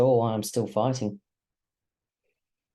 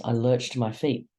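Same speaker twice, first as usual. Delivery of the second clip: ordinary delivery, nothing marked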